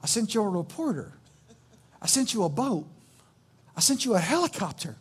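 soft, patient reply with pauses; loving, smiling. Acted. Jesus answering a disappointed man, loving and patient. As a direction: gently count off each thing, smiling. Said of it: "Jesus smiles lovingly and says".

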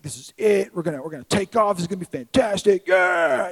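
hyping himself up about the new building; excited. Retold or imitated.

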